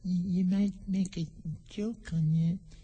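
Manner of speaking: tiny, high, halting insect voice; high, soft, hesitant little voice; extremely small voice. A narrator's reading. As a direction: squeak it softly, with pauses. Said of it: "an extremely small voice".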